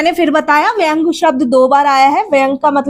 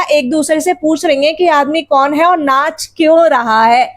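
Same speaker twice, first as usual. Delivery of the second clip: usual speaking voice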